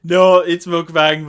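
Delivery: loud, slow insistence; loud correction. Real, not acted. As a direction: insist it firmly. Correcting co-host loudly.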